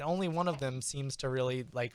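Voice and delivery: ordinary talk, nothing marked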